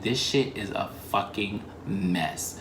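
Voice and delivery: low, soft, slow muttered curse; cursing quietly at herself; muttered aside. Retold or imitated. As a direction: grumble it under your breath. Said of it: "and I was like".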